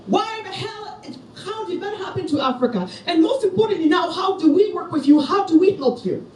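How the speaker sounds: high, soft, incredulous student voice with a laugh; astonished students. Retold or imitated. Speaker quoting Berkeley students reacting to her talk, high and amazed. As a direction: play the stunned student asking how to help. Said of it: "They're busy being like".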